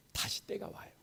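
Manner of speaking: hushed whisper of hope; preacher whispering to the congregation. Real, not acted. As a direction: breathe it like a secret promise.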